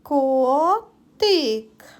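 Котик split into two drawn-out syllables; careful two-part Котик. Shown as a demonstration. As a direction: sound out Котик slowly, pausing between syllables. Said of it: "Зробимо звуковий аналіз слова котик".

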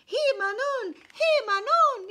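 frantic high loud call; desperate cry for help. A narrator's reading. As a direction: shout for help across the field. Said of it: "he cried out".

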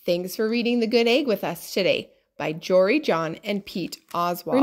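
usual speaking voice

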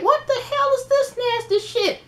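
high, far-off mock disgust, spat out; disgusted outburst. Reacting to a guest throwing up, high mock disgust. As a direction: recoil at something gross.

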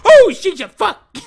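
sudden loud high scream; startled scream. Real, not acted. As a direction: scream in real fright. Jumped by monster in game.

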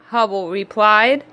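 ordinary delivery, nothing marked

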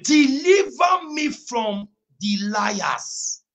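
high, loud, very slow shouted scripture; full-force preaching. Acted. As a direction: thunder the verse over the congregation.

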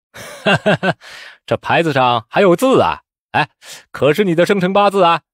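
amused ridicule after a ha-ha; mocking laugh. A narrator's reading. Examiner making fun of the gold plaque, laughing mockingly. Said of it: "那考官哈哈一笑道".

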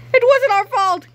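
high, loud protest; pleading cry. Acted. Harold protesting to principal Krupp, high loud plea. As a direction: cry out in defense. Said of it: "cried Harold".

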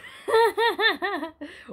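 high burst of genuine laughter; real laugh. Real, not acted. Reader cracking up at the insult to the Klamath, laughing. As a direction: laugh out loud at the story.

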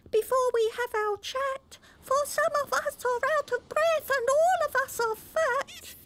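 very high squeaky oyster voices; little oysters crying out. A narrator's reading. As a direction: squeak it like tiny worried oysters. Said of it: "the oysters cried".

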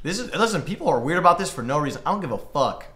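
usual speaking voice

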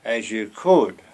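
normal talking voice

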